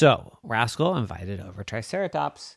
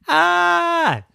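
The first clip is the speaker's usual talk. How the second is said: high frightened dinosaur shriek; 9 dB louder than usual. Acted. Fear of being eaten.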